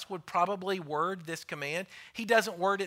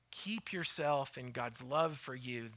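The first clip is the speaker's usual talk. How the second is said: soft true version; 5 semitones lower, 7 dB quieter than usual. Shown as a demonstration. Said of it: "He doesn't say".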